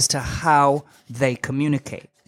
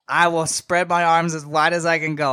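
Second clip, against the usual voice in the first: normal talking voice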